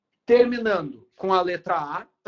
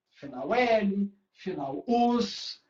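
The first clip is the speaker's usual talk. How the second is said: very slow, each ending laid out; slow spelled-out endings; much slower than usual. Shown as a demonstration. Teacher to students, slowly showing the endings. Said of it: "olha só".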